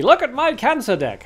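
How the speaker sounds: high, loud mocking whine; sneering impression of a boastful viewer; mocking chat. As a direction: parody a proud viewer showing off his deck. Mocking what chat posted.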